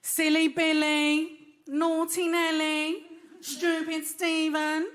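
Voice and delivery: slow, lightly mocking parent voice; a gentle, prim telling-off, drawn out; mild Western parent scolding. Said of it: "Western parents ... they're like".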